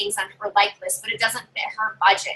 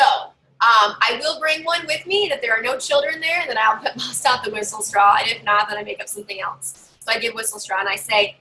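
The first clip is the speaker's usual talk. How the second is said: ordinary talk, nothing marked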